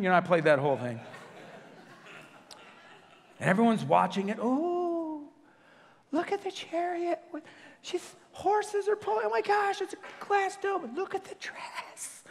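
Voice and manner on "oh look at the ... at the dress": high, breathless gushing over the wedding; swooning fan voice. Retold or imitated. Preacher mocking wedding fans: high, gushing, ends hushed. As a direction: squeal it like a starstruck fan, fading to a hush.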